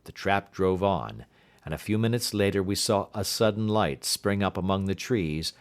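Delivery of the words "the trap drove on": usual speaking voice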